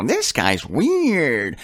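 slow, drawn-out mock scoff; a skeptic scoffing at a preacher's website; dismissive scoffer. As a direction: stretch it out like a sneering skeptic. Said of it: "look at their websites and go".

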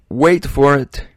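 playful announcement. Shown as a demonstration. Teasing build-up to Facebook point.

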